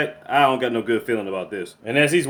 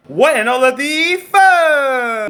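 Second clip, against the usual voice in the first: loud, high, drawn-out cry of disbelief; stunned outburst; 11 semitones higher, 7 dB louder, much slower than usual. Real, not acted. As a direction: stretch it out into a stunned yell. Stunned reaction to axe killing.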